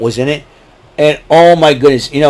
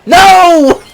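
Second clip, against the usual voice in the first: loud high shout; 6 dB louder than usual. Retold or imitated. Quoting the movie, a loud high shout. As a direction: yell it out. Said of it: "we would quote the movie".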